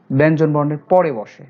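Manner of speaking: ordinary talk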